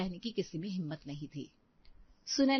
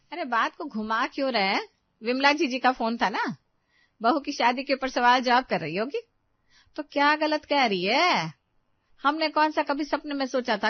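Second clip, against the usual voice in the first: loud, higher, angry challenge; angry scolding; 6 semitones higher, 7 dB louder than usual. Acted. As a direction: scold him loudly for dodging the question. Angry relative confronts about phone call. (गुस्से में ज़ोर से डांटते हुए)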